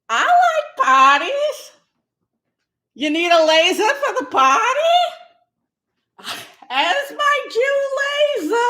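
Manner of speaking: high, excited mock cheer; party-loving caricature. Comic stays in character at a party, hammed-up whoops.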